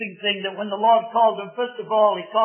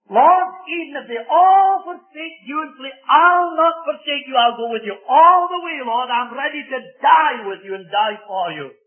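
boastful declaration; 5 semitones higher, 5 dB louder than usual. Acted. Preacher voices Peter's great boast.

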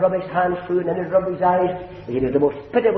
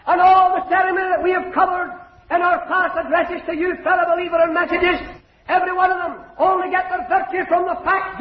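impassioned preaching; 12 semitones higher than usual. Acted. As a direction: plead hard with the unsaved listener. Preaching on the altar.

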